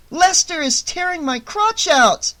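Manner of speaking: high loud shriek. A narrator's reading. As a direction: scream in pain and outrage. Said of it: "Annie shrieked".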